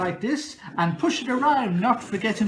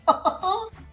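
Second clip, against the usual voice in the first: zany giggle; 5 dB louder than usual. Acted. Host's character laugh.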